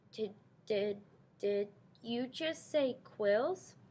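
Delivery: soft, slow frightened stammer. A narrator's reading. Terrified mouse asking the porcupine about quills, stammering. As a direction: stumble over the words in fear. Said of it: "she stammered".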